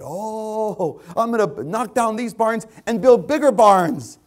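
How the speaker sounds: hammed-up, high, loud boasting; boastful rich man. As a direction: crow it, building to a mock shout. Mocks the rich man's boast.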